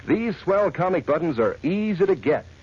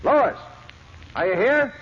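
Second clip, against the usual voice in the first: raised voice, calling from afar; 5 semitones higher than usual. Acted. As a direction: call into the dark house. Calling for Lois in house.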